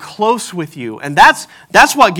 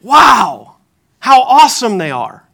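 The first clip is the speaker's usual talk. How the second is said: louder, higher delighted parent's wow; proud parent awe; 4 semitones higher, 6 dB louder, slower than usual. Retold or imitated. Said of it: "from the perspective of a parent".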